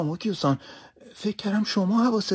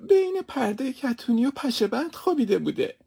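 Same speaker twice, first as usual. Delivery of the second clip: high, tearful protest of innocence, louder; tearful pleading; 7 semitones higher, 3 dB louder than usual. Acted. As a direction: cry out your defence to the master. Servant still crying explaining.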